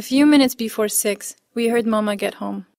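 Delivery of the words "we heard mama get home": ordinary delivery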